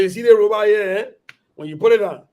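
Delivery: high, loud, fast-paced Liberian English pitch. Retold or imitated. Speaker pitching a robot to Texas Tech professors in Liberian English. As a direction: rattle it off in thick Liberian English. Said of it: "The real Liberia English, yo".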